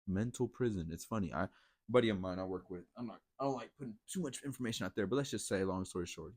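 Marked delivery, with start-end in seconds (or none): none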